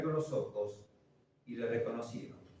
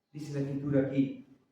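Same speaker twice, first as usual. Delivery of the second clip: ordinary delivery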